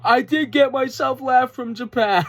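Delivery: high, loud, slowed-down promo imitation; Tekla. Fan mimics wrestler correcting herself, loud crowing impression. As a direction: crow it high and loud, hammed up. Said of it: "laughs to herself a minute and goes".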